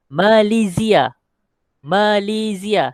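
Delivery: Malaysia said slowly as "malizia", the Arabic way; Arabic pronunciation demo. Shown as a demonstration. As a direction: model Malaysia as "ma-li-zi-a" for the class to repeat. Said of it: "Sebut-sebut ramai-ramai".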